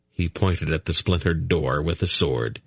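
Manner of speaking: usual speaking voice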